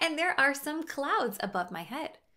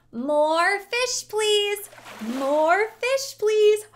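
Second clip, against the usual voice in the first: "More fish, please" repeated, growing louder each time; building louder ask; 6 semitones higher, 8 dB louder, much slower than usual. Shown as a demonstration. Host leading kids asking for fish, getting louder. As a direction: call it out more and more eagerly. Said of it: "Let's keep asking".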